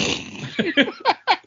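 a bright burst of laughter, falling away; high guilty laugh; sudden high laugh. Real, not acted. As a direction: laugh out loud at himself. Laughing at not sharing beer.

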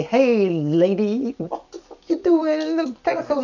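hammed-up rude hello to a stranger; mock rude greeting. Mocking rude greeting to NPC.

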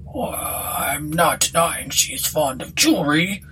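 slow, reluctant country landlord; embarrassed village innkeeper, drawn out; bashful landlord. Acted. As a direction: admit it sheepishly in a rustic voice. Voices bashful landlord in story.

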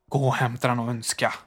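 ordinary delivery, nothing marked

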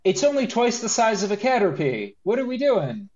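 exasperated incredulity; incredulous. Real, not acted. Outraged mockery of the design.

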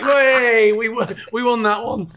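high, loud cheer, slowing at the end; mock victory cheer. Telling partner they won, a loud laughing cheer.

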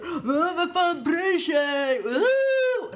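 put-on high, slow, drawn-out drunk shout; drunk partygoer. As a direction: wail it like a careless drunk friend. (hög, långsam berusad utrop)

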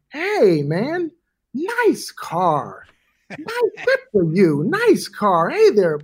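high, sing-song fawning greeting; hammed-up schmoozy voice, pitch leaping up; gushing Katzenberg impression. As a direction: mimic an overeager fan cooing at the car.

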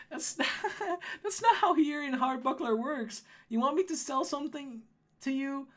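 laughing gnome saleswoman, starting high; Holly, scoffing. Acted.

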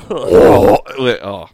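ordinary delivery

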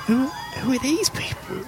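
put-on high-pitched girl, questioning strangers; young girl's voice. Girl eyes the band, young girl voice. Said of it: "then she looks at you lot".

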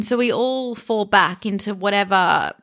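normal talking voice

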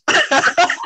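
a burst of high, loud laughter; big laugh. Real, not acted. Host laughing at the doorbell horse, loud high laugh. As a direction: crack up at the horse joke.

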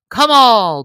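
first shout of come all; loud call. A narrator's reading. Little John calling the crowd, first loud call. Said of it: "Thus he shouted".

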